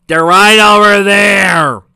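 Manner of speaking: high, loud, slow shout of disbelief; frustrated shout. Real, not acted. Reader shouting at heroes ignoring the emeralds, high and loud. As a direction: bellow it at the screen, fed up.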